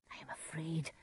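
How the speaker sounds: very soft, hushed confession; afraid. Acted. Macbeth confesses his fear to his wife, afraid. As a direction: admit the fear almost in a whisper.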